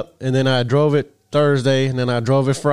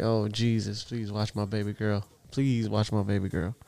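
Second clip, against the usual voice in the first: much softer, pleading under breath; muttered prayer; 3 semitones lower, 9 dB quieter than usual. Retold or imitated. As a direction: pray quietly for your daughter. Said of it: "I was like".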